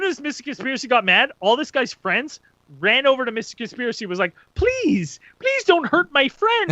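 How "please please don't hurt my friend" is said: high, loud mock pleading; begging. Friends begging Mister Conspiracy for mercy, begging. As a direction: imitate a desperate plea, drawn out.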